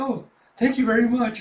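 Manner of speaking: high, loud over-polite thanks; flustered thanks. Acted. Yor thanking the saleslady before shuffling away, flustered. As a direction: thank her too brightly before escaping.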